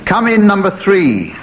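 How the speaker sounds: understated scream from a tagline; mild mock scream. A narrator's reading. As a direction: call it out as a mock scream, without full volume. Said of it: "screamed the Cardinal".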